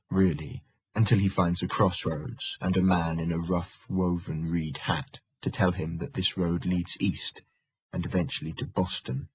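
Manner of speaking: plain reading voice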